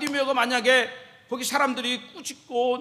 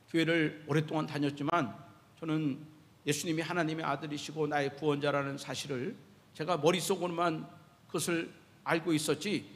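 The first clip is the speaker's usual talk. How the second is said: ordinary talk, nothing marked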